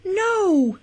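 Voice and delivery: high, drawn-out cry of protest. A narrator's reading. Paige protesting Nathan's accusation, high-pitched cry. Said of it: "Paige protested".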